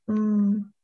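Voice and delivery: ordinary delivery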